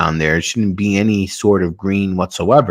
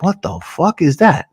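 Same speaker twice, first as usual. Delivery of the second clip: retold bafflement; 8 semitones higher than usual. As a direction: act out his baffled double take. Pilot spots animals below.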